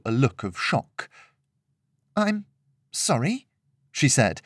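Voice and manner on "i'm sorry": high, puzzled, questioning; genuine incomprehension. A narrator's reading. Shocked guest to angry host, genuinely puzzled. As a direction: ask it as if truly baffled. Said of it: "as if she genuinely didn't understand the question".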